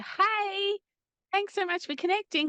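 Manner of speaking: chirpy greeting. Retold or imitated. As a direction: put on a bright, sugary greeting. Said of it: "it's kind of like".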